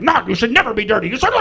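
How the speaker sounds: put-on high, quavering rant of an old lady; old woman losing it. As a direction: imitate an elderly lady losing her mind. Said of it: "And she lost her mind. She was shaking".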